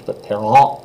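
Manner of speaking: parent with the vowel pushed up into the nose; nasal French en in parent. Shown as a demonstration. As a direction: say parent through the nose like a goose. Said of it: "sounds like you're pushing it up into your nose".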